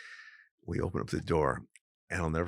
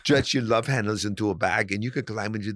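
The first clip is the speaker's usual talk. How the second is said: put-on higher, louder Austrian muscleman accent; Hans bodybuilder voice; 4 semitones higher, 4 dB louder than usual. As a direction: boast in a pumped-up Austrian accent like a gym bully. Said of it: "we're talking like Arnold Schwarzenegger".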